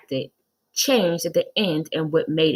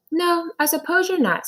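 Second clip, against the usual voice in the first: high reluctant lady; 12 semitones higher than usual. A narrator's reading. Reading Mrs Jules aloud.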